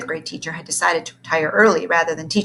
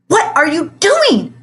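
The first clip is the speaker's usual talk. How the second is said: high, loud, slow shriek; 10 semitones higher, 9 dB louder, slower than usual. A narrator's reading. The librarian shrieking at the hiding teacher, high and loud. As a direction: shriek at him in alarm. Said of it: "she shrieked".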